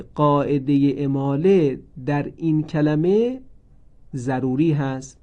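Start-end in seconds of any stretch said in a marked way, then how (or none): none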